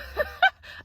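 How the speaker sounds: breathy, high giggle of delight; high gleeful laugh. Real, not acted. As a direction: laugh out at the liar. Laughing at the lie.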